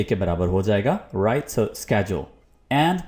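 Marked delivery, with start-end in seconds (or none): 1.7-2.2 schedule with 'sk', SKED-jool; American pronunciation. Shown as a demonstration. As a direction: open schedule with a hard 'sk'. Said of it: "इसकी आवाज़ आएगी". (schedule American ढंग से, 'स्केड्यूल')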